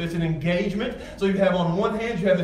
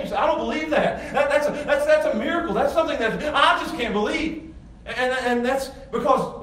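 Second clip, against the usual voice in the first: full-force preaching; 7 semitones higher than usual. Acted. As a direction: preach it out, marvelling and climbing.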